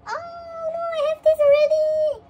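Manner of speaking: high, drawn-out squealed lament; dismayed wail. Real, not acted. Collector pulling a duplicate message card, dismayed wail. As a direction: wail loudly at bad luck.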